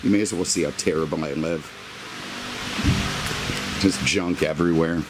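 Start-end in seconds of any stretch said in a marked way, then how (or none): none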